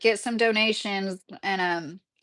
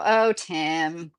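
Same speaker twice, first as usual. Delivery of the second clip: usual speaking voice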